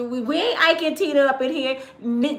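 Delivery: ordinary delivery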